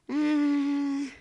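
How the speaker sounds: long, high, loud "uh"; a drawn-out mock groan, pitched high; dismayed "uh". Reacting to being told to curb enthusiasm, a dismayed "uh".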